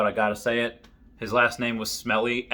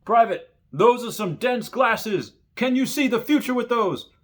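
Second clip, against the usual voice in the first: drill sergeant mocking; 11 semitones higher than usual. Drill sergeant teases private about glasses, high mock bark. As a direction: needle the recruit like a sneering drill sergeant. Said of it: "one of my drills ... says".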